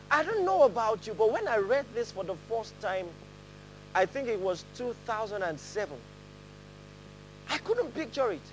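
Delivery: ordinary delivery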